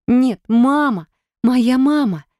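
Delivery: high, loud, slow wail; anguished cry. Acted. Sher realizes the truth and cries out for her mother. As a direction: call out for her mother in despair.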